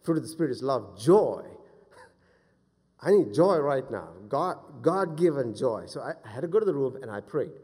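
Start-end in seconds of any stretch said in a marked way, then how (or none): 0.0-5.9 loud, emphatic plea for joy; impassioned preaching. Real, not acted. Preacher crying out his need for joy, impassioned preaching. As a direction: lean hard on the key words.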